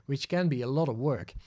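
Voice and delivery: normal talking voice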